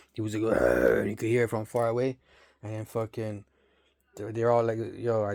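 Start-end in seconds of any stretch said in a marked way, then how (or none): 0.4-1.0 very low, drawn-out imitated monster growl; monster roar. Storyteller imitating the monster heard from far away, low roar. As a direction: drop deep and growl like a huge beast. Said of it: "they had a big monster dude".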